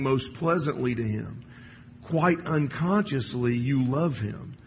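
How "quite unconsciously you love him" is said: "Quite unconsciously" said slowly and deliberately; slow down and weigh each word; slow emphasis. Shown as a demonstration. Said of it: "I would emphasize the word unconsciously".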